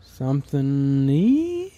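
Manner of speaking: slowly spelling it out letter by letter; halting, slow spelled-out guess. Shown as a demonstration. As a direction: drag each letter like a guess. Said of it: "spelling out a guessed command".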